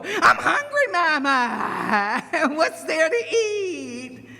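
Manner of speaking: put-on high, wailing child snake; whiny hungry child. Snake Son comes home asking Mama for food, whining. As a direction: whine it out, stretching the last words.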